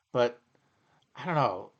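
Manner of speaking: ordinary delivery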